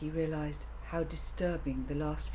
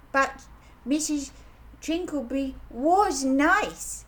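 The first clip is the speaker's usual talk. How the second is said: insisting; 9 semitones higher, 7 dB louder, slower than usual. A narrator's reading. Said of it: "insisted Jinx".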